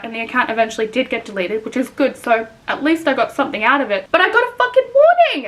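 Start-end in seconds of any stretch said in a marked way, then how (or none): 4.1-5.5 pitched way up, indignant; outraged cry. Real, not acted. Telling viewers about the unfair warning, indignant. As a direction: throw the injustice up high.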